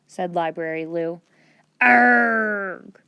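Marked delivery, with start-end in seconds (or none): 1.8-3.0 frustrated pirate arrgh. Acted.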